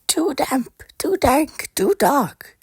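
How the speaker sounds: tiny, very high mouse voice, half whispered; high whispery mouse complaining of the damp cave; squeaky mouse. Acted. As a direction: squeak it out like a nervous little mouse. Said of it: "Mouse squeaked".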